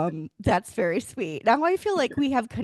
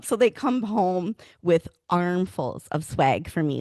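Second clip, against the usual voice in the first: ordinary delivery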